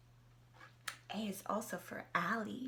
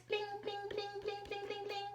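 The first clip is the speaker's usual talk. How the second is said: fireworks sparkle; 8 semitones higher, 7 dB quieter, faster than usual. Acted.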